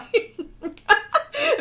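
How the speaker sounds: high shrieking laugh. Real, not acted. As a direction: let a sudden squeal of laughter out. Laughs at chat translation.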